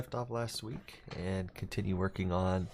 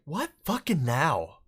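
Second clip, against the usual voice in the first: slow, drawn-out exasperation; fed up, what now; exasperated; 6 semitones higher, 4 dB louder, slower than usual. Retold or imitated. Exasperated quote about audio ghosts.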